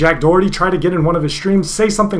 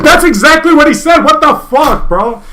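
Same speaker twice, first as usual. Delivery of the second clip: loud, high-pitched disbelief; worked-up incredulous protest; indignant outburst; 6 semitones higher, 3 dB louder than usual. Real, not acted. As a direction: exclaim it in exasperated outrage. Indignant at what friend said.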